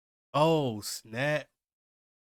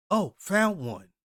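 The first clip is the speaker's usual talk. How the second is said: excited find; 7 semitones higher, 5 dB louder, slower than usual. Retold or imitated. Character finds a survivor.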